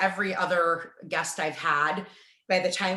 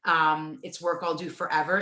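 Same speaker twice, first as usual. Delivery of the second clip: usual speaking voice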